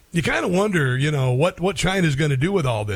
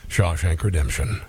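much lower, deep actor impression; Morgan Freeman; 10 semitones lower than usual. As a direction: drop right down into a famous deep-voiced actor, gently correcting. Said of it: "Morgan Freeman would be very upset. He'd be like".

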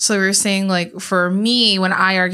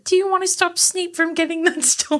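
high-pitched put-on voice; high, level-voiced imitation; character impression; 10 semitones higher than usual. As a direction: quote the movie kid, pitched way up. Quoting movie character in put-on voice.

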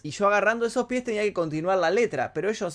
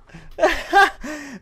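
loud, high burst of laughter; delighted laugh; 9 semitones higher, 6 dB louder than usual. Real, not acted. Laughs at the replayed clip.

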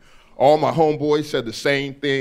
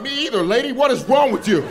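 startled back; 10 semitones higher than usual. Retold or imitated. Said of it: "I was like".

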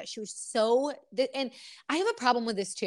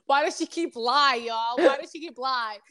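laughing mimic; 5 dB louder than usual. Echoing friend, laughing.

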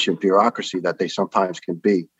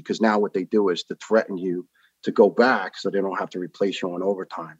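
usual speaking voice

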